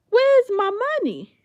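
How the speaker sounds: high, loud demanding yell; loud angry where's my money. Retold or imitated. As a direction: demand it back, furious and loud. Retold confrontation over stolen money.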